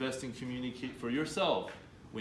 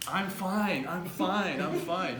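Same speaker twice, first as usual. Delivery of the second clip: higher, louder hollow insisting; mock fine; 6 semitones higher, 5 dB louder, much slower than usual. Shown as a demonstration. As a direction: act out someone pretending to be fine. Demo of fake fine.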